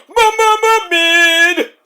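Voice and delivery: high, drawn-out stuttering; hammed-up high verdict; comic stutter. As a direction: stutter the first sound, then stretch the word.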